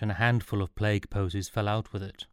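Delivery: ordinary delivery, nothing marked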